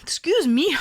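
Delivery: right after a sneeze; sneezing. Real, not acted. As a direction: sneeze then a loud excuse me. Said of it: "I have to sneeze".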